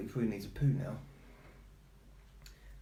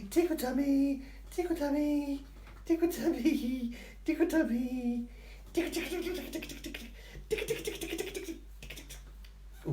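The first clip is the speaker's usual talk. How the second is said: tickle the dog's tummy playfully. Real, not acted. Tickling the dog, baby talk.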